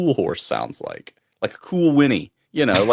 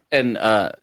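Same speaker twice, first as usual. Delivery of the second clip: normal talking voice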